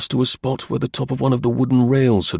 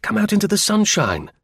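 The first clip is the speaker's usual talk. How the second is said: much higher, coaxing call; high brotherly exclamation; brother crying out; 10 semitones higher than usual. A narrator's reading. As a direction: call her outside in a high, lively voice. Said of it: "cried her brother".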